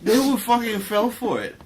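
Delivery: high, loud and delighted; excited outburst. Real, not acted. Co-host delighted that people will fall for it, excited. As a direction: burst out high and loud after a laughing fit.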